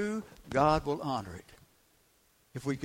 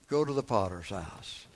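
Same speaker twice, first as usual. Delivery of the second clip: normal talking voice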